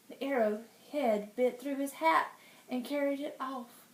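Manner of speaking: ordinary delivery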